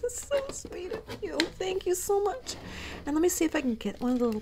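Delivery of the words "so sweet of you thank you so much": high, loud woo into teary thanks; bursting with happy tears; joyful cheer. Real, not acted. Overjoyed cheer at gift.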